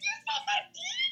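squealing disbelief, laughing through it; excited shriek. Retold or imitated. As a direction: shriek like a starstruck fan. Shocked fan reacts, laughing.

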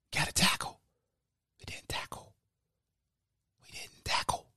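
breathy frustrated whisper of missed tackles. Real, not acted. Analyst whispering his frustration about missed tackles. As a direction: drop to a whisper, shaking your head.

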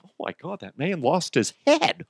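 lightly voiced horrified onlookers; hint of squeamish disgust; mock disgusted viewers. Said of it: "looking at me like".

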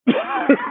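sudden high laugh at himself; high burst of laughter. Real, not acted. Laughing at his own drink order.

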